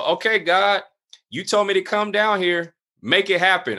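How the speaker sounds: retold prayer, pitched high, put as a demand; verbatim challenge to God. Man tells God to deliver, challenging. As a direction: re-enact putting God on the spot. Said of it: "I just said it like that, verbatim".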